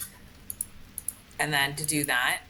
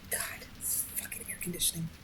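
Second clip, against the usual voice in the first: cursing under your breath; muttered whisper; 9 dB quieter than usual. Real, not acted. Gamer cursing the air conditioning in a whisper. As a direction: hiss a curse at the air conditioning.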